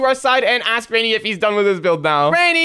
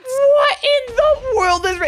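high, drawn-out cry of shock; shocked exclamation; 12 semitones higher, slower than usual. Acted. Watching Rainy's explosions destroy everything, shocked. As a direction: stretch out the alarm slowly.

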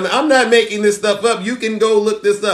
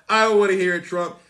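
normal talking voice

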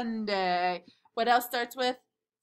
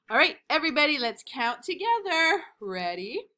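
kindergarten teacher voice; 4 semitones higher, 5 dB louder, faster than usual. Acted. Kindergarten class lead.

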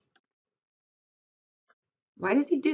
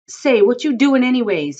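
high, loud challenge; raised voice, demanding to know; crying out; 9 semitones higher, 12 dB louder than usual. A narrator's reading. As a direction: cry out in protest. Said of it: "he cried".

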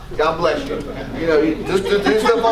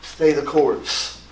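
God's firm reply; 6 semitones lower, 4 dB quieter, slower than usual. Retold or imitated. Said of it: "But God says".

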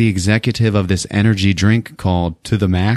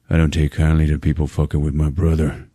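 retold low warning; 5 semitones lower than usual. Jimmy confronts men mocking his brother.

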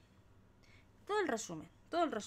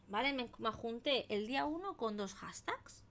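ordinary talk

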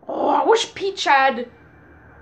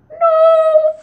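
dismayed shout; 14 semitones higher, 8 dB louder than usual. Real, not acted. Loud dismay.

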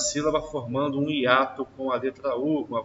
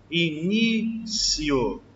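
slow drawn-out word; 3 semitones higher, much slower than usual. Shown as a demonstration. Teacher slowly stretches the example word for the class. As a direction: stretch every syllable slowly. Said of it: "teacher drawing out example word".

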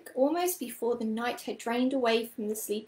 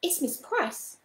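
higher-pitched, lightly hushed astonishment; hushed surprise; 4 semitones higher than usual. A narrator's reading. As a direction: say it in mild, hushed disbelief. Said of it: "her voice was hushed with surprise".

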